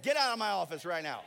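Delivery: loud, fast mock dismissal; mock outraged order. Preacher retelling his order to a pastor, mock outrage. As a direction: throw him out with comic indignation. Comeback to pastor's question, retold.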